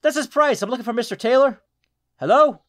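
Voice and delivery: high, eager caller's voice; high-pitched visitor announcing himself; polite official caller. Acted. Plays Price calling at the cabin.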